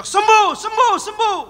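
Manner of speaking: high, loud cry for healing; imitated shouting. Wife praying for sick husband, high imitated shouting. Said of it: "ibunya teriak".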